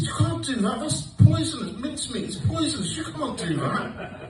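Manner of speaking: panicked warning. Retold or imitated. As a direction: warn her like her life depends on it. Retold panicked warning about poison.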